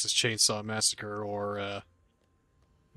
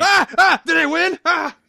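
excited yelping; 10 dB louder than usual. Real, not acted. Winning the cash game.

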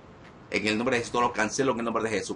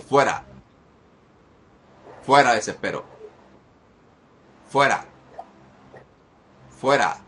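loud, punched single-word orders; forceful raised-voice banishing; exorcism command; 3 semitones higher, 5 dB louder, slower than usual. Real, not acted. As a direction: fire off each command with force, pausing between. Commanding demon out.

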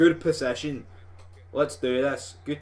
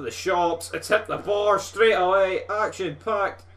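higher, louder rush as the shot hits the bar; excited commentary; 7 semitones higher, 6 dB louder than usual. Real, not acted. Gamer reacting to his shot striking the crossbar, higher and excited. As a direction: call the near miss with a jolt.

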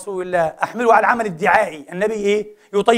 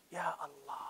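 whispered wonder; awed whisper; 5 semitones lower, 25 dB quieter than usual. Real, not acted. Preacher marvelling at the captives' new clothes, whispering. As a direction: breathe يا الله in a hush.

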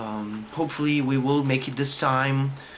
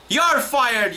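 higher-pitched, exasperated outburst; angry firing; 7 semitones higher than usual. Real, not acted. Gamer firing the stupid game, angry and indignant. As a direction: declare it with indignant force.

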